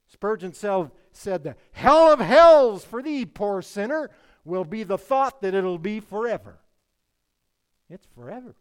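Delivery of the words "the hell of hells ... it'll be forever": booming, high-pitched preacher's roar; thundering quotation. Acted. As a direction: thunder it out as a dire warning, drawing out the first words. Preacher thunders a Spurgeon quote.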